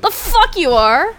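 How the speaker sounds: slow, loud, high defiance; defiant shout. Acted. Arrested young man defying the cop, loud and slow. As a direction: spit it back at him.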